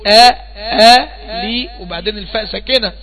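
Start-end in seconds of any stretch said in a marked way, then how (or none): 0.1-1.4 آ stretched long and loud, held open; drawn-out aa. Shown as a demonstration. Teacher holding the آ out long for students to copy. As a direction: hold the آ long like a teacher showing the sound. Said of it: "انا بقول ايه؟".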